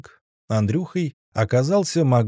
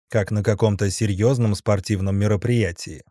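usual speaking voice

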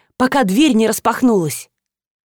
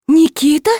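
high, loud alarmed call of a name; wife shouting her husband's name in alarm; frightened cry; 7 semitones higher, 4 dB louder than usual. A narrator's reading. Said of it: "закричала Галина".

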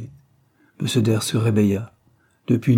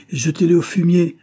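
much higher and louder, disgusted order; angry command; 8 semitones higher, 6 dB louder than usual. A narrator's reading. Anthony ordering the maid to throw the rags out, angry command. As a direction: bark it out in disgust.

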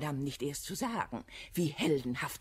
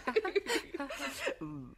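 affected laugh. Acted.

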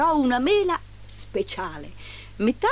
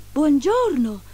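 higher, louder greeting; 7 semitones higher, 5 dB louder, slower than usual. A narrator's reading. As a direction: greet the caller in a lifted voice.

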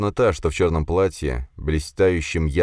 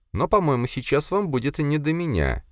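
high-pitched, ladylike voice; a lady's high, knowing remark to her suitor; coy lady; 10 semitones higher than usual. A narrator's reading. Lady warns the count.

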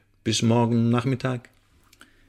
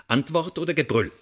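taunting, pitched-up question, daring him to refuse; mocking threat; 7 semitones higher than usual. Interrogator taunts his prisoner with a mocking threat. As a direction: needle the prisoner, give him a choice that's no choice.

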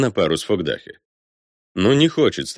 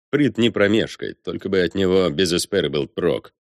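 usual speaking voice

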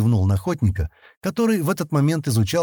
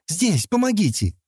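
high narrated call for help; 9 semitones higher than usual. A narrator's reading. Injured girl to her rescuer, calling for help. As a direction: call out where you are, pitched high.

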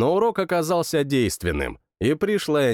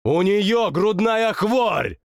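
slow, higher shouted outburst; father shouting to stop her lecture; 7 semitones higher, slower than usual. Acted. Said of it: "крикнул я".

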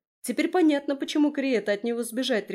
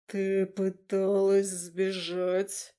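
dragon rumbling; much slower than usual. Acted. A dragon questions the runaway girl, slow rumble. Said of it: "пророкотал ей прямо в мысли".

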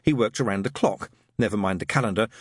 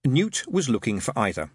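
ordinary delivery, nothing marked

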